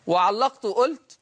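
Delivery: normal talking voice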